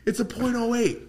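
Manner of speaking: high laughing scold; mock scolding glee. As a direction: gloat at him like a told-you-so.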